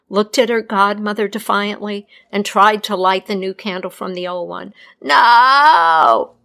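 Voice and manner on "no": loud, desperate No; scream. A narrator's reading. Death screaming to stop the healer, loud. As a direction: shout to stop her. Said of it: "screamed Godmother Death".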